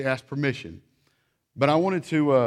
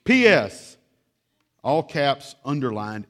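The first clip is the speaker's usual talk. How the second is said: mock loud; 7 dB louder, slower than usual. All-caps PS.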